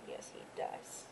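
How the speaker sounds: soft whispered agreement; whispered to dog. Real, not acted. Owner whispering fondly to her old beagle.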